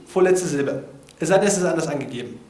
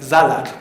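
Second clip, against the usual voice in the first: "Salat" stressed as SA-lat, firmly placed; stress on first syllable; 5 semitones lower, 6 dB louder than usual. Shown as a demonstration. Speaker showing how he says Salat, stress on SA in "Salat". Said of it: "Ich betone, ich, nein, ich sag".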